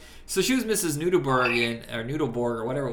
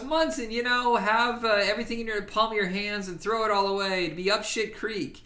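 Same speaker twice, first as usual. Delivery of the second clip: quoting character; 8 semitones higher than usual. Retold or imitated. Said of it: "And he goes".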